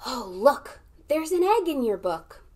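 ordinary delivery

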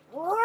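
short, loud playful lion roar; a read-aloud mock roar, loud and high; ferocious roar. A narrator's reading. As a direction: roar it out like a proud young lion. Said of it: "ferocious roar".

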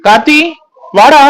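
short, carrying call. Acted. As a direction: call a friend over. Calling him over.

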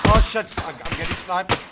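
high, loud startled yell. Real, not acted. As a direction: shout in sudden alarm. Player being sniped.

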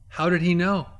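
usual speaking voice

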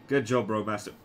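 ordinary talk, nothing marked

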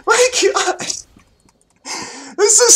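high, cracking through laughter; laughing disbelief. Real, not acted. Gamer laughing at a silly play, high.

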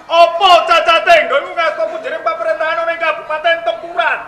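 high, loud protest; indignant challenge. Acted. Regent defending Tempuran to a critic, in loud indignation. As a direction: declaim it loudly, well above normal pitch. Ruler disputes his regency's flaw.

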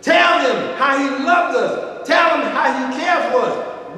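loud, high, sung-out preaching; fiery gospel preacher calling out; preaching at full force. Acted. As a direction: whoop it to the congregation, drawing words out.

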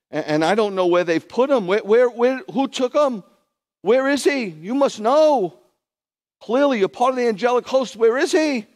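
frantic high shouting, far above normal voice; hammed-up hysterical demand, very loud; crying woman, acted. Weeping Miriam demands her Lord from the angels, frantic high shouting. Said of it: "She's crying and she's got mucus".

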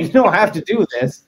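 normal talking voice

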